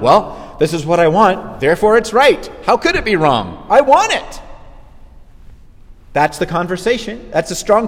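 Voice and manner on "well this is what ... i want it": brutish person. Retold or imitated. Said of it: "and they're like".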